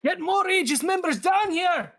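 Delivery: high, loud call for backup; shouting for help. Acted. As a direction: yell across the clearing. Guard calls for backup.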